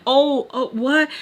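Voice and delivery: high, startled re-enactment; acted freaking out. Her reacting to the reminder, acted high-pitched freak-out. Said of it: "I'm, like, freaking out".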